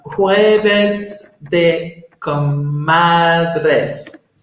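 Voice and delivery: very slow "Jueves de Comadres", drawn out; slow, careful repeat. Shown as a demonstration. Speaker repeating a foreign name for a listener, very slow and careful. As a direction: stretch out each word of "Jueves de Comadres" for a learner.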